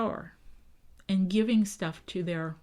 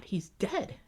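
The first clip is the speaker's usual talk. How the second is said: shocked whisper; 5 dB quieter than usual. Retold or imitated. Pequots react to chief's death.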